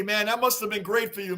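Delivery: ordinary talk, nothing marked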